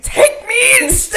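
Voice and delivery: high, loud mock sobbing plea; crying plea. The Lion begs to be taken instead, hammed-up loud sob. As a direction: beg through fake tears. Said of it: "He was like".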